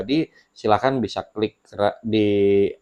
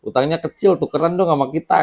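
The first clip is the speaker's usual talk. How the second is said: higher-pitched mock-envious ribbing; playful teasing; 7 semitones higher than usual. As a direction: tease a friend in joking banter. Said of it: "kita kadang-kadang ledek-ledek kan". (meledek sambil bercanda)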